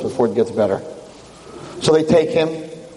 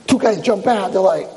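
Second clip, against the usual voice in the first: ordinary talk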